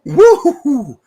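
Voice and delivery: loud high whoop after the drink; loud, high woo-hoo after a shot. Real, not acted. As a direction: holler as the liquor burns.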